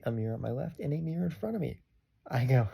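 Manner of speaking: usual speaking voice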